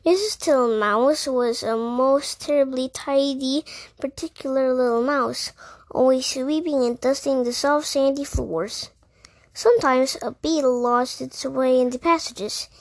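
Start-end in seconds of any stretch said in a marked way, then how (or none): none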